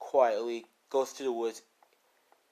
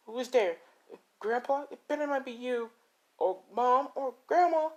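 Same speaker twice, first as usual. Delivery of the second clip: high, nervous calls into the dark; climbing higher and more scared; frightened calling out; 13 semitones higher than usual. Acted. Deku calling to family in the dark woods, frightened and high.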